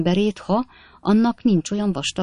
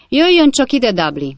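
much higher and louder call; 8 semitones higher, 6 dB louder than usual. A narrator's reading. Officer summoning Dudley from the corridor, loud call. Officer calls his man.

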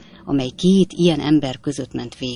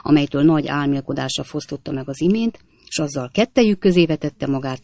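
plain reading voice

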